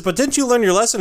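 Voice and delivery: high protesting question; indignant old witch. Retold or imitated. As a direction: squawk in disbelief. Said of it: "And she says".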